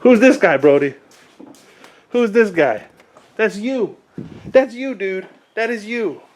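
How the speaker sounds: high, playful parent talk. Real, not acted. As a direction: tease a little one in a bright high voice. Showing baby his own image, playful.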